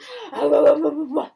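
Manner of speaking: gasp, then loud babbling "wa-wa-wa"; playful babbling noise. Shown as a demonstration. Mother showing game she plays with baby, babbling noise. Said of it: "I go like this to him".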